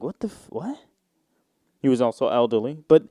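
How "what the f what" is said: stunned disbelief. Retold or imitated. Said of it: "I was like".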